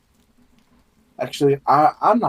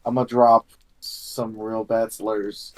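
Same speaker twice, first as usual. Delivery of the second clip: ordinary talk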